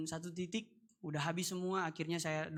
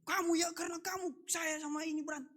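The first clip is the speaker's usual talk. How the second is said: imitating an old woman scolding, higher; mock the angry neighbour oma; retelling the oma's angry scolding, pitched higher; 11 semitones higher, 4 dB louder than usual. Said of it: "Dia marah-marah ke saya".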